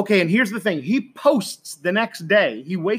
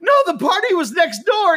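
high, louder amused quote; high, louder retold host; quoting the hosts; 10 semitones higher, 4 dB louder than usual. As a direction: pitch up and tell him kindly. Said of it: "And they said".

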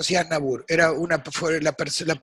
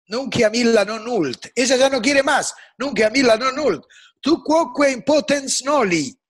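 higher, louder Latin verse; impassioned recitation; 7 semitones higher, 5 dB louder than usual. Shown as a demonstration. Guest declaims Catullus's lament, loud and high. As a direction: declaim the lines with rising passion. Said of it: "el poema es Cátulo ocho".

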